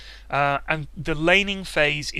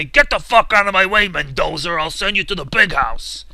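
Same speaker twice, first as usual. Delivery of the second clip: gruff cop-movie shout, much louder; dirty cop threat; 5 dB louder than usual. Pretend. Threatening a teammate like a dirty cop, loud and gruff. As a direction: bark it like a movie cop pinning a perp. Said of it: "like a dirty cop ... and say".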